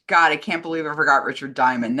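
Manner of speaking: usual speaking voice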